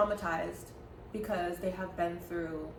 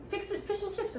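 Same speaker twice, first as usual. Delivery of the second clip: mock British accent; 11 semitones higher than usual. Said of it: "What's British?".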